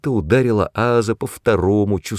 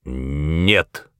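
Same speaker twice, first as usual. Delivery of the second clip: lower, final-sounding no; firm refusal, pitched down; curt refusal; 4 semitones lower than usual. A narrator's reading. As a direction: cut the discussion off dead. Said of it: "отрезал он".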